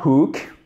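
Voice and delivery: hook with a breathed H; aspirated H. Shown as a demonstration. Teacher models it for viewers, aspirated H in hook. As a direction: sound the H in hook clearly. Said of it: "Faites bien le H aspiré".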